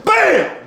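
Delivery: loud, high "bam" sound effect; mock impact shout. Reviewer acting out knocking him out with the gun, shouted impact. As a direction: shout it like a landed blow.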